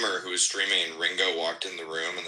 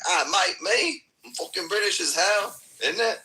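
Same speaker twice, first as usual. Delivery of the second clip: high, loud hammed-up British accent; fake British accent; 12 semitones higher, 3 dB louder than usual. Insisting to a doubting local that he's British, in a loud hammed-up British accent. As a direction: bluff it out in an over-the-top British accent. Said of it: "talk in a British accent".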